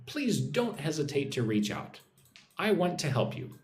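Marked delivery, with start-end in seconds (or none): none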